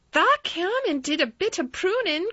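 high, loud country lad; Dickon crying out. A narrator's reading. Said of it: "cried Dickon".

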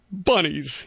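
high little bunny voice, weeping; tearful bunny. A narrator's reading. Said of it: "began to cry with all his might".

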